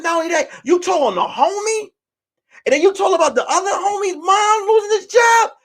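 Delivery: high-pitched, incredulous telling-off; outraged accusation. Retold or imitated. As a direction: scold a friend in retold outrage. Acted quarrel with indiscreet friend.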